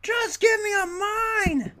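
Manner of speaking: very high, loud, slow wailing shout; frustrated cry. Retold or imitated. Gamer mock-wailing at the game for a mine, high and loud. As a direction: wail it like begging. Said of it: "You're like".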